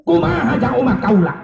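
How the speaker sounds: high, urgent pleading question; anguished plea. Retold or imitated. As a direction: press the question on a high, sustained pitch. Retold Prophet repeats his anxious question. (nanya bari melas, sora luhur)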